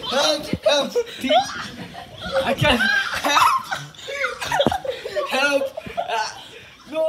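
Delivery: high, loud cries for help; mock panicked shouting. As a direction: yell for help in fake panic. Playful panic, calling for help.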